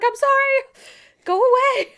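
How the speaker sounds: squeaky panicked apology to hornet; high pleading voice. Retold or imitated. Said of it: "I was like".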